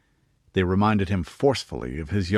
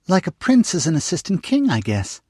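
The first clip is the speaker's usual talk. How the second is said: put-on high, eager little girl; young girl voice; 10 semitones higher, 4 dB louder than usual. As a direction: pitch it up like a curious young girl. Narrator voices young girl Jane.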